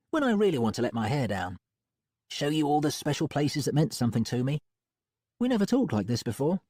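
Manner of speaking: high, lively female voice; Molly's voice. A narrator's reading. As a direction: lift it up into Molly.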